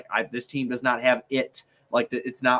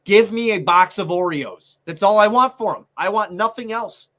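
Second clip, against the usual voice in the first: fed-up outburst; 5 semitones higher, 6 dB louder than usual. Real, not acted. Fed-up rant about the player.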